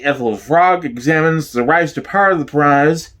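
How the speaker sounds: ordinary talk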